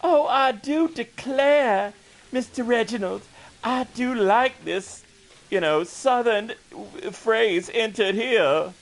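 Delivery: high, slow, hammed-up Southern belle drawl; mock Southern belle. Mocking how Northerners picture Southerners, hammed-up Southern belle voice. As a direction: play a swooning Southern belle, overdone. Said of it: "everybody who's Southern to go like".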